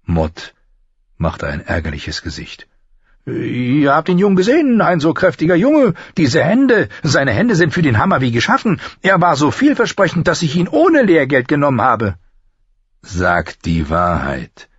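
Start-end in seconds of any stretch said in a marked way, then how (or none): 3.2-12.2 very high, insistent craftsman voice; high-voiced smith praising his apprentice; master armourer, protesting. 13.0-14.7 slow, measured demand; slow, insistent lord; urging. A narrator's reading. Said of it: "drängte Ned".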